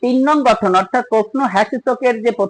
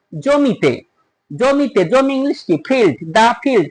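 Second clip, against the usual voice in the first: ordinary talk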